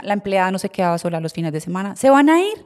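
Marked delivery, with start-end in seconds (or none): 1.9-2.6 high, loud startled question; alarmed question. Retold or imitated. La empleada pregunta alarmada. (la empleada pregunta alarmada: agudo y fuerte)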